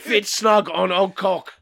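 slow, grunting caveman. Pretend. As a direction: speak like a dim caveman. Caveman Og bit.

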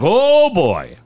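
playing the showman calling a guy down; a hint of a hypnotist. Hypnotist calling a man down from the balcony, mock stage voice. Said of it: "He's like".

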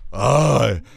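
drawn-out, very slow I am Groot; mock Groot line. Mocking Vin Diesel's one line as Groot, very slow and drawn out. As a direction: stretch it out like a hammy Groot.

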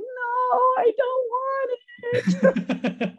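put-on high, stretched-out childish wail; hammed-up bawling refusal, laugh underneath; mock crying. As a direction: drag the words into a squealing whine.

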